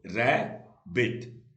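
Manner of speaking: Rabbit very slow, split in two; long pause between the two syllables of rabbit. Shown as a demonstration. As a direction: sound out rabbit part by part. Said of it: "कितने syllable का word है".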